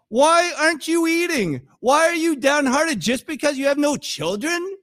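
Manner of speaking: high, loud, incredulous questioning; exasperated husband. Acted. Husband questioning weeping Hannah, hammed-up exasperation. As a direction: play the baffled husband, pitch pushed up. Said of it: "He's the husband".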